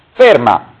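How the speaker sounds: loud, sharp order; man shouting from the audience; shouted stop. Acted. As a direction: yell it to halt her. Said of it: "urlò un uomo sbracciandosi".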